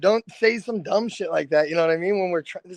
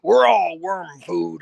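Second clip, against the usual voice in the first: gruff bodyguard; 2 semitones lower than usual. Retold or imitated. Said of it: "Yim's the type, he's like".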